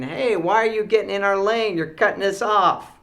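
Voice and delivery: put-on high, loud kid protest; indignant kids. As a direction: imitate children complaining loudly. Kids protesting being cut off.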